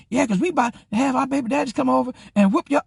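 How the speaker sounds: high-pitched angry mimicry; imitated women's threat. Women threatening to bring men over, imitated high voice.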